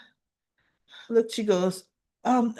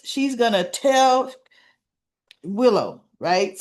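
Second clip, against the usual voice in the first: ordinary talk, nothing marked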